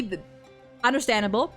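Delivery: ordinary talk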